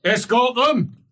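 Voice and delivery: high, loud command; forceful military shout; shouted order. Acted. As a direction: bark it to the troops. Dwarf gives an order.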